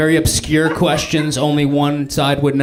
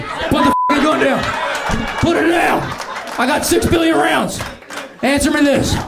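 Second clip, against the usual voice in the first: high-pitched, strained yelled orders; far right guy with bigger gun; 12 semitones higher than usual. Acted. Far right guy ordering someone to disarm, high frantic yell. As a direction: yell it like an over-armed hothead. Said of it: "their guns would be way bigger".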